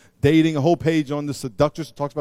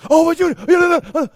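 sputtering anger; 11 semitones higher, 8 dB louder than usual. Acted. Acted angry sputter.

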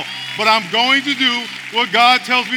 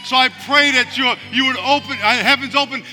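raised, steady praying voice; fervent prayer; 3 semitones higher, 6 dB louder than usual. Acted. Pastor praying aloud over the congregation, fervent. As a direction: pray over them with conviction.

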